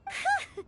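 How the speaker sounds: relieved giggle. Acted. Relieved laugh.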